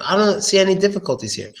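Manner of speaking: usual speaking voice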